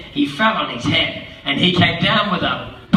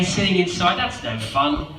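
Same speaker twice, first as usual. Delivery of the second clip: usual speaking voice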